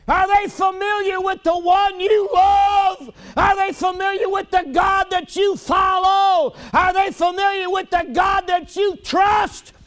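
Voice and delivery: high, loud preaching cry; fiery preacher shout. Acted. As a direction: preach it out at full force to the congregation.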